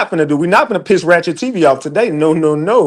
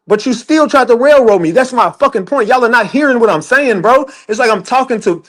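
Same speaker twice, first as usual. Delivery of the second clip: ordinary talk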